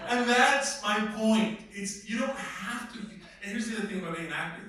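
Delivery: ordinary talk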